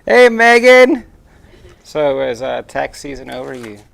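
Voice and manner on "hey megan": big, high, loud hello called out; loud greeting. Real, not acted. Husband calling out a big hello to a viewer on the live stream. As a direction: call out the greeting with gusto.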